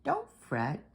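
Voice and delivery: high little mouse voice; lightly voiced mouse; squeaky mouse. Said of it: "Mouse squeaks".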